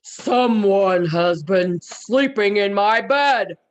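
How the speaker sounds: slow, booming bear voice; loud, weighty storybook bear; Papa Bear's great big voice. Acted. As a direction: thunder it slowly as a huge papa bear. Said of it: "Papa Bear said in his great big voice".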